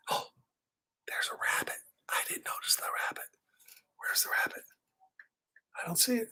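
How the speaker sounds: put-on hushed child's whisper. Reading to kids, whispering as the character. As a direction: breathe it like a startled little one.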